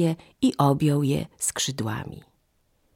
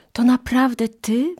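put-on high, louder burst of amazed children; excited exclamation; 8 semitones higher, 5 dB louder than usual. A narrator's reading. Children exclaim to their father the goose, high and excited. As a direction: cry it out in surprise, pitched up. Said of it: "wykrzyknęły".

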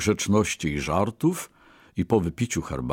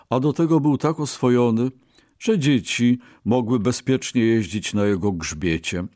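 usual speaking voice

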